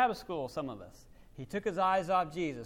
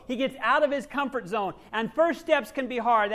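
normal talking voice

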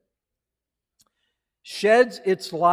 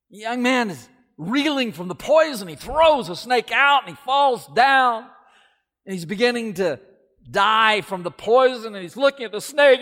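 rising alarm; 8 semitones higher, 7 dB louder than usual. A narrator's reading. Climax of parable, snake bites.

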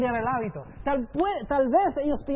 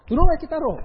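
louder and higher, insisting; exasperated insistence; 5 semitones higher, 9 dB louder, much faster than usual. Acted. Preacher insisting to doubters, loud exasperation. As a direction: push back, incredulous.

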